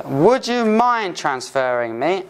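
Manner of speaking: slow, careful teacher model of "Would you mind transferring me?"; slow polite request. Shown as a demonstration. Teacher modelling a polite phone request slowly for students. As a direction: lay out each word slowly for the class.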